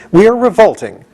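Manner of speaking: high, loud, leaning on are; fierce. Acted. General Ginger defies the gatekeeper, fierce and high. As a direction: declare it fiercely. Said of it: "exclaimed the general fiercely".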